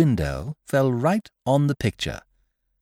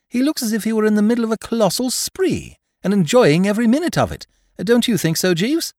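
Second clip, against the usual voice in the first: high, eager, gossipy exclamation; lively, high-voiced opinion; animated agreement; 9 semitones higher, 3 dB louder than usual. A narrator's reading. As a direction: pitch right up, warming to the theme. Narrator's character enthusiastically criticises the portrait.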